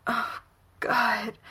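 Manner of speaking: moaned with a cry in it; moaning. A narrator's reading. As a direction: let it break into a moan. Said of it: "he moans again".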